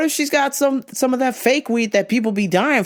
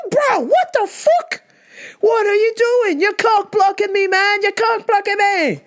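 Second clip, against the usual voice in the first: very high whiny protest; mock exasperated high yell; retold outraged complaint; 10 semitones higher than usual. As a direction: wail your complaint at the guy, pitched way up. Retold quarrel with biker.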